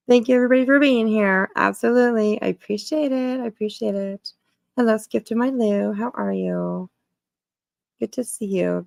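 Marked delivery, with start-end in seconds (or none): none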